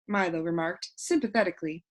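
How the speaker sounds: ordinary delivery, nothing marked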